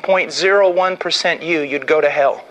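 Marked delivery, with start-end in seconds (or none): none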